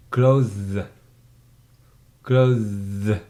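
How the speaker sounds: "Clothes" as one syllable with a voiced th-z ending; correct pronunciation model. Shown as a demonstration. Teacher corrects pupil, "Clothes" in one syllable, buzzed th-z ending. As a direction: model "Clothes" slowly and firmly, buzzing the end. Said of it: "is not right. Pronunciation".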